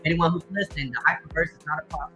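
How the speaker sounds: usual speaking voice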